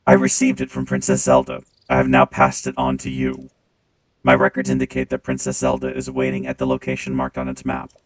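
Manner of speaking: deep, loud clanging machine voice; clangy robot voice. Acted. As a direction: speak through a booming robot filter. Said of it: "when I turn on the robot voice".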